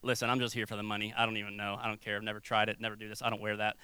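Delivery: low, quick, bored worker who doesn't care; uncaring worker. Retold or imitated. Restaurant worker telling a customer off, low bored voice. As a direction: mumble it fast like you're just here for the money. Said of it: "they tell you, like".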